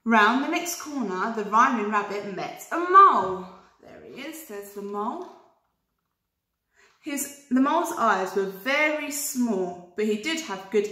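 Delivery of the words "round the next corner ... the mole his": usual speaking voice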